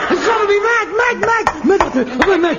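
high, loud repeated ranting; losing it over the clock; frantic shout. Acted. Ranting about the cuckoo.

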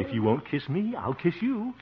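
rising, eager declaration; bold advance. Acted. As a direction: pitch climbing with nervous daring. Working himself up to kiss her.

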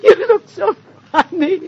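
high, laughter-squeezed voice, near crying; weepy with laughter; laughing. Acted. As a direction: squeal it through helpless giggles. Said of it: "I'm laughing".

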